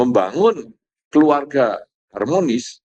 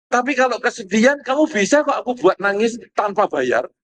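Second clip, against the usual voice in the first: arguing spouse; 7 semitones higher than usual. Retold or imitated. Spouse argues horror over drama.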